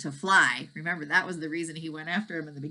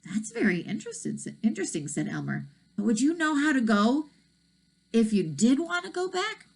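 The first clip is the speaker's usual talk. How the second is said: put-on boy voice; 6 semitones higher than usual. A narrator's reading. Elmer speaks.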